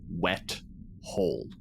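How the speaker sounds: ordinary talk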